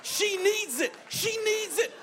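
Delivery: high, slow, shouted insistence; imitating an excited convert's fervent cry; urgent plea. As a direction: beg loudly on someone else's behalf. Excited waiter pleading for waitress.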